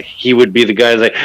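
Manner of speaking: ordinary talk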